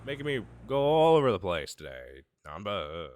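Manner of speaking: loud, exasperated grumble; mock complaint. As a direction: play up the annoyance.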